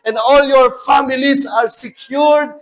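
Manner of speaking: loud, high preacher's proclamation; preaching at full force. Acted. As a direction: preach it out, building.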